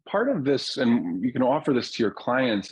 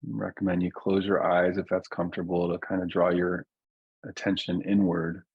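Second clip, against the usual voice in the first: guided meditation. Real, not acted. Teacher settling a group into their bodies, calm guided meditation. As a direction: ease listeners down gently, leaving long silences. Leads a slowing-down grounding meditation.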